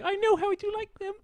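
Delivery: a high little Cockney voice presenting food; Cockney doll voice. Retold or imitated. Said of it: "Cockney accent".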